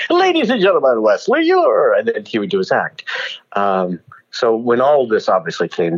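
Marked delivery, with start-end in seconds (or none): 0.1-1.9 high, ringing announcer call; stage introduction. Retold or imitated. Star introducing the singer to the crowd, showy announcement. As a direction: present the act to the crowd, pitched up.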